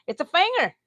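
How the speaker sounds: high, loud Texan twang on "finger"; Texan accent. Shown as a demonstration. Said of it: "That's my accent word".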